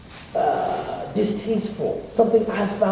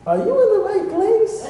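high, amused Pakistani uncle voice; pakistani accent; 13 semitones higher than usual. Retold or imitated. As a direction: play the puzzled Pakistani uncle. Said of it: "as soon as the Pakistani uncle saw me, he said".

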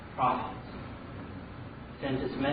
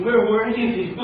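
higher, louder aggrieved question; acted reproach, voice raised; indignant demand; 7 semitones higher, 7 dB louder, slower than usual. Drowned man to angel in heaven, indignant.

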